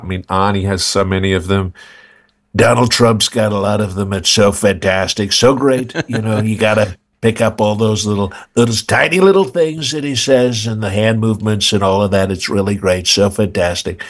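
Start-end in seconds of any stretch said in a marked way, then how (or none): 2.5-14.0 boastful tycoon voice, lots of superlatives; Donald Trump. Acted. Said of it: "that Trump was amazing".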